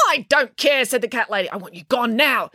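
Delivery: put-on high, emphatic old lady retort; angry dismissal. A narrator's reading. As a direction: shut them down crossly. Cat lady angry in quarrel.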